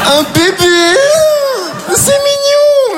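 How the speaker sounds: squealing high, drawn-out cooing; fake delight. To the father, hammed-up high cooing over the baby. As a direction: gush falsely over the baby.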